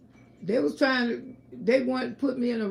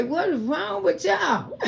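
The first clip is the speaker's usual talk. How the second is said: laughing; 6 semitones higher than usual. Retold or imitated. Mother laughs at family.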